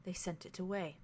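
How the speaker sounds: softly voiced elder, barely above a murmur; hushed secret words. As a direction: say it quietly, almost to yourself. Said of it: "they very softly say".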